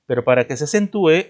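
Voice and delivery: ordinary talk, nothing marked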